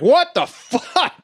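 high, drawn-out mock panic; hammed-up alarmed outburst; retold freak-out. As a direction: stretch it out in a high-pitched imitation of shock. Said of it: "She freaks out".

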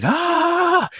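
high loud scream of pain. Retold or imitated. Retelling the suspect screaming from the taser, high and loud. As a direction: let out the tased man's scream.

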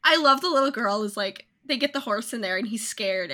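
ordinary talk, nothing marked

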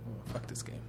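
muttered disgust at the game; muttered aside. Real, not acted. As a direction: grumble it to yourself, fed up.

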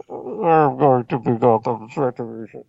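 hint of a muffled villain voice; lightly voiced masked villain; Bane voice. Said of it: "Did he sound like Bane to you?".

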